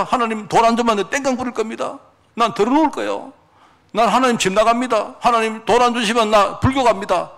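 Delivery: high-pitched, pestering prayer; mock petulant threats. Preacher mimics a believer threatening to leave God, mock petulant. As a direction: play a demanding believer nagging God.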